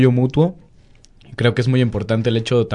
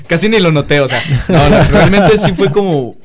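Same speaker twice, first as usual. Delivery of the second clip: words spilling out through laughter, louder; laughing while talking; 5 semitones higher, 7 dB louder than usual. Real, not acted. Still laughing while telling friends about the accent. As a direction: keep laughing as you talk.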